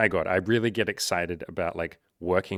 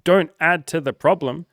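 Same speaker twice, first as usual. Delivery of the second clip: louder, higher, worked-up urging; fired-up rant; 4 semitones higher, 6 dB louder than usual. Real, not acted. Host lecturing men about wasting women's time, fired-up and loud. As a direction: push the men hard, get heated.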